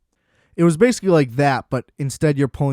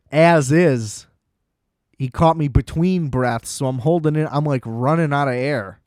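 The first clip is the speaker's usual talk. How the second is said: ordinary talk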